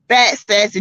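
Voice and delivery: ordinary talk, nothing marked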